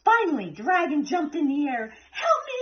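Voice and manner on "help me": high frantic scream. A narrator's reading. Dragon jumping in fright, high scream for help. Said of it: "he screamed".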